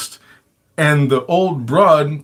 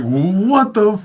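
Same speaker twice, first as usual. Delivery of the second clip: high, startled cry of alarm; jolted awake and scared; panicked yell; 8 semitones higher than usual. Retold or imitated. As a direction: blurt it out in sudden panic. Waking in panic, yelling out.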